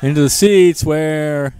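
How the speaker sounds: loud, slow, drawn-out call. Real, not acted. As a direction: belt out the foul ball. Foul ball call.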